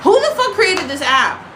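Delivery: who on earth made this; outraged. Real, not acted. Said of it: "outraged at the app".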